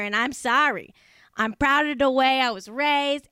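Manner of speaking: broad mock Boston with dropped r's; hammed-up Boston accent, swooping and stretched. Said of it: "whole thing again in a Boston accent".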